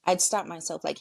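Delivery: ordinary delivery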